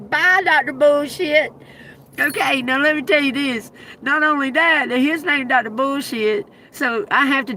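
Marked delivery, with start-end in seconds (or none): 0.1-1.5 sassy goodbye. Retold or imitated. Her retort to the doctor.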